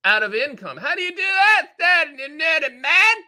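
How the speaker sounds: very high, loud comic character voice; Uncle Fred. Acted. Tipsy uncle demanding answers of the annuity man, high and loud. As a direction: screech it like a worked-up tipsy relative. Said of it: "that's like my Uncle Fred's voice".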